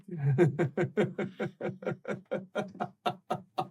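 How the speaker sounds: long eerie laugh; creepy laugh. Acted. Creature laughs.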